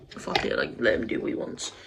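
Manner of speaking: whispered, giving up; resigned whisper. Retold or imitated. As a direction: breathe it out like you've stopped caring. Giving up, quoted inner thought.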